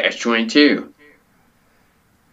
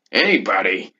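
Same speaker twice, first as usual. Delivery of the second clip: normal talking voice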